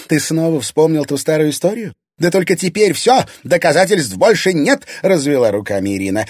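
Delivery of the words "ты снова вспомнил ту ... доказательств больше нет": high, raised, triumphant retort; defiant gloating. A narrator's reading. Irina telling father the evidence is gone, defiant gloating. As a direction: throw it back higher and bolder.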